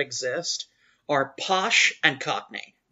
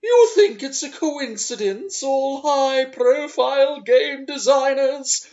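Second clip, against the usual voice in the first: Duke Amiel impression; 10 semitones higher, 6 dB louder than usual. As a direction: declaim the comment grandly, rising to a loud peak. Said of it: "fucking excellent Duke Amiel".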